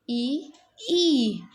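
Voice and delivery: ई, ई first clipped then held long; पहले ह्रस्व फिर दीर्घ ई; short then long ई. Shown as a demonstration. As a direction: contrast a quick इ with a drawn-out ई. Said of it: "इस प्रकार इसका उच्चारण होगा".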